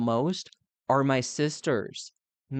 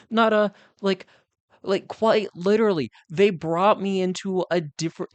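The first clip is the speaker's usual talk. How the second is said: ordinary talk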